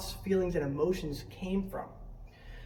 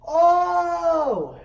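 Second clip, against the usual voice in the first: high, loud, long "oh" dropping at the end; loud drawn-out cry; 9 semitones higher, 9 dB louder than usual. Acted. Reacting to a truck dumping trash, loud cry.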